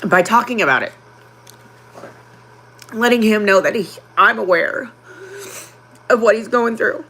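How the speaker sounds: holding back tears while speaking; getting emotional. Real, not acted. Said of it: "I'm getting emotional".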